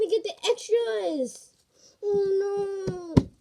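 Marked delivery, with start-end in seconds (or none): none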